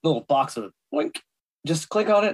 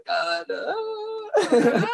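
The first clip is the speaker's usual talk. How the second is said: high squeaky laugh. Real, not acted. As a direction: break into giggles. Laughing over unknown character.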